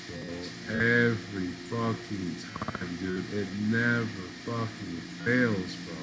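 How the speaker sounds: normal talking voice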